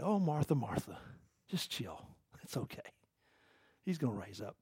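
very soft, low reassurance; Jesus, gently pleading. Retold or imitated. Consoling Martha after her brother died, hushed and pleading. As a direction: soothe tenderly, almost under the breath. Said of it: "He was kinda pleading with her".

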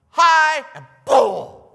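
spring out to scare someone; loud playful boo; sudden startle shout. Shown as a demonstration. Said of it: "Sometimes I do this to people".

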